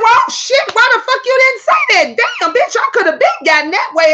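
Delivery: high, loud outburst of disbelief; indignant retort. Retold or imitated. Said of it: "I said".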